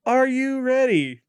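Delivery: high, drawn-out "are you ready"; hammed-up announcer call. Host hypes up his co-host for the game, hammed-up announcer call. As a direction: stretch it out like a game-show host.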